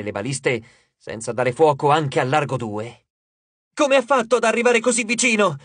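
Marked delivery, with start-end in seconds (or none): 3.7-5.6 shouted question; shouting. A narrator's reading. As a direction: call it out over the chaos. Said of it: "gridò Annabeth".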